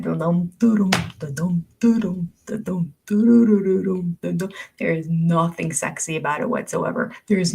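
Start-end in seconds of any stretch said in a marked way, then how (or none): 0.0-4.5 bouncy dum-dum waddle rhythm; imitated waddle sound. Mocking a woman's waddling walk, bouncy sung-out syllables. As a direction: sound out a lumbering waddle, beat by beat. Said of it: "in my head, hear that".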